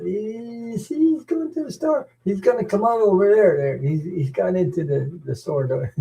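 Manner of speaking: high-pitched mock wife voice, excited; imitating wife. Husband retells wife watching him from car, high excited mock voice. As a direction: squeak it out like an eager wife pointing. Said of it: "So my wife said".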